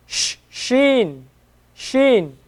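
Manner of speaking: soft "sheen" with a drawn-out sh; the sheen sound for the learner; Arabic sheen. Shown as a demonstration. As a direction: make the sh long and soft. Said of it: "So seen, sheen".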